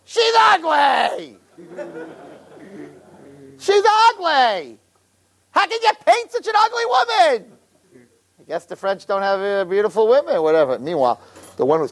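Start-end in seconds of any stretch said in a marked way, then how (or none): 0.0-7.5 put-on high, slow, shouted old lady outrage. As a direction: shriek at Ethel like a scandalized yenta. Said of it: "Myrtle says to Ethel".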